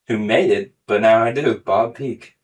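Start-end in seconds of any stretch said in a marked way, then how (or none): none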